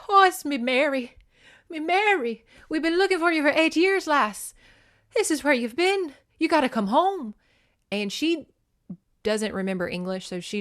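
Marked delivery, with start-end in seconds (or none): 0.1-7.4 put-on big hearty Irish accent, pitched high and loud; a booming Irish father finding his lost daughter; Donovan's Irish voice. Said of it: "this giant Irish guy".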